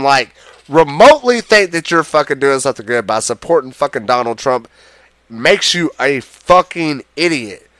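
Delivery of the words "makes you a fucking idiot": ordinary delivery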